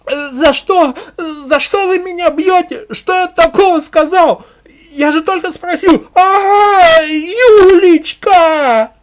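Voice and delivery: high, loud tearful protest rising to a scream; desperate pained shouting; cries under blows. A narrator's reading. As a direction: wail as a man being beaten. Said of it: "послышался крик и звуки ударов".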